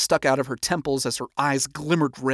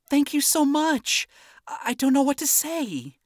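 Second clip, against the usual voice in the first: quiet gratitude; 13 semitones higher than usual. Acted. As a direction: gush thanks while holding back. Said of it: "quietly exclaimed in gratitude".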